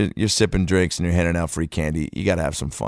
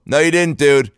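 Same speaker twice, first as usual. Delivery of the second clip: high, loud contradiction; indignant correction; 8 semitones higher, 5 dB louder than usual. Retold or imitated. Adult behind kid who claimed thanks, indignant loud correction. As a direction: cut in and call the kid out. Calling out rude kid, acted.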